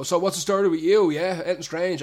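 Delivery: imitating a pushy customer, loud and high; loud pushy punter. Pub regular quizzing the barman, loud and pushy. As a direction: barge in with the question, loud. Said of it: "and they'll go".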